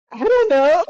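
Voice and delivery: put-on high, loud whine of an upset child; imitated crying son. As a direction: mimic a tearful kid with no answer. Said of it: "there's times where he'll cry about something".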